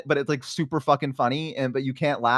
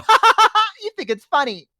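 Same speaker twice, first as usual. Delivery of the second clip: high, loud mock cackle; gleeful kid; 5 dB louder than usual. Kids catching babysitter smiling, gleeful teasing. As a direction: crow like a kid who caught you laughing. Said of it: "and they go".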